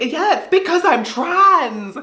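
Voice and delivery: high, slow, theatrical complaint; mock dramatic lament. Making a scene at immigration, high and slow. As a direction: play it up for the crowd, high and drawn out. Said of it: "I was like".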